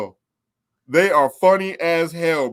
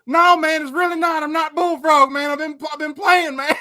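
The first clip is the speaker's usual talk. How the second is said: owning up; 10 semitones higher, 4 dB louder than usual. Retold or imitated. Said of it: "I go".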